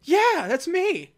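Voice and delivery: high, slow and loud; hammed-up alien reply. The alien admits who he is, high and slow. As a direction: stretch out a goofy yes it's me. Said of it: "And he goes".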